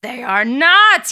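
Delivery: slow, loud flat denial; yelling. A narrator's reading. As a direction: yell the denial, drawn out. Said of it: "yells Nikos".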